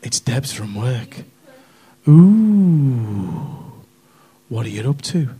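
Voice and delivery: low, drawn-out phone come-on; imitated Debs's voice. Debs from work rings Colin at home, hammed-up low flirtation. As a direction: put on a low, suggestive caller. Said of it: "She said".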